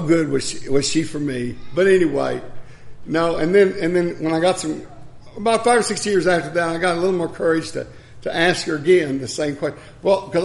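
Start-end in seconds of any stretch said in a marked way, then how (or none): none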